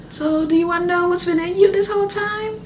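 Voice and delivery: high, light playful question; teasing midwife. Retold or imitated. Midwife teasing the new mother, high and playful. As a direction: ask it high with a teasing lilt.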